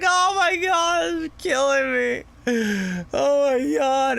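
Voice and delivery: high, slow laughing friends; friends cracking up. Retold or imitated. Friends laughing at him in the living room, high and slow. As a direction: squeal it out like friends dying of laughter. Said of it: "They're like".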